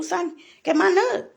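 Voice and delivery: normal talking voice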